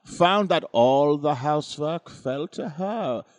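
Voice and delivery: put-on theatrical, swooping Eastern European accent; mock Ukrainian accent. Reading the ghost story aloud, hammed-up Ukrainian accent. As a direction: ham it up in a bad Ukrainian accent, bursts high and loud then dropping. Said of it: "you made me do the accent".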